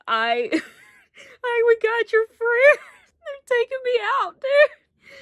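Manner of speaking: real helpless laughter, high and squeaky; cracking up so hard it turns to crying. Real, not acted. Vlogger overwhelmed by a movie moment, laugh-crying.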